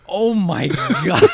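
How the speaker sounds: high, loud, drawn-out disbelief; mock astonishment. Girlfriend hears the police bluff, imitated amazed reaction. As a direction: stretch it out in imitated shock.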